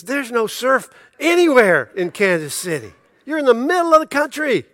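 high, loud, laughing disbelief; can't believe his brother is leaving the surf; older brother mock-aghast at Kansas City; incredulous brother.

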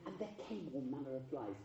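lighter. Shown as a demonstration. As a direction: keep it light and soft. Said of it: "a weeny bit lighter than that".

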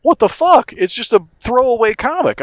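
high, loud exclamation; outraged. Real, not acted. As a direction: yelp it in outrage. Outraged at the prize.

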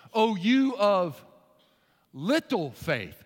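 very slow, drawn-out words; Jesus scolding Peter, slowed down; slow rebuke. Pretend. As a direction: chide gently and slowly. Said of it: "What did he just tell Peter".

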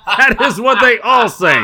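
laughs through the punchline; laughing. Real, not acted. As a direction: crack up at your own joke. Laughing at own joke.

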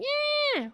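long, very high imitation yell; mocking yell. Podcaster imitates a politician yelling outside, high mock yell. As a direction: mimic her yelling in a high screech. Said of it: "outside somewhere yelling".

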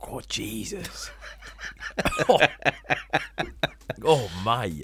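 gasping at a sour sip; reaction to sour drink. Real, not acted. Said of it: "You talk about me pulling faces".

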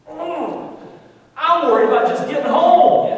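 high, loud comic fretting; mock money panic. Man realising he forgot tax and tip, mock panic. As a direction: wail it, hammed-up and high.

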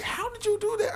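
very high falsetto disbelief; put-on squeaky wonder; mock astonishment. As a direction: imitate amazed clients, voice sky-high. Mimics astonished clients.

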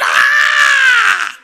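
high thin cheering yell; mock crowd cheer. Preacher voicing the gym crowd cheering, a high yelled ah. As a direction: imitate the whole crowd cheering.

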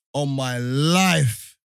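On my life, stretched slow and loud; drawn-out emphasis. Real, not acted. Telling friend the punchline is coming, slow and loud. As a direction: drag it out to hook the listener. Emphatic build-up to funny bit.

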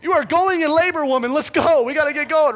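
high, loud hurried pleading; frantic urging. Retold or imitated. Husband urging his laboring wife, frantic and loud. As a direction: hurry her out the door.

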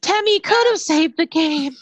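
high, loud squeaky cartoon creature; Temmie voice. Echoes in character voice.

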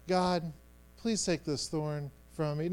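nice polite prayer. Acted. Man saying a nice prayer to God, politely soft. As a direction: say it as a mild, tidy little prayer. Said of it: "in this nice prayer".

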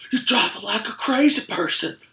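very loud, high imitated scolding; enraged mock yell. Worker berating the kid for his driving, imitated yell. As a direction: yell it as the outraged track worker.

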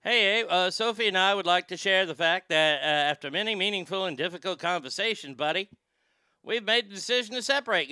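a hint of a Canadian accent with buddy and guy; mock Canadian. Comedian imitating Trudeau's announcement, mock Canadian. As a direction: lightly play Trudeau reading his statement, eh. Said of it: "Trudeau's announcement, "buddy", "guy".

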